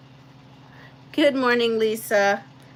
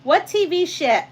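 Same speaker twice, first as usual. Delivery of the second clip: normal talking voice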